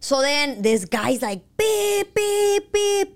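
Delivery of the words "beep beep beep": high nasal beeps like a honking horn; mock horn honks; imitated car horn. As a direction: beep like an angry car horn. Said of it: "pitándome".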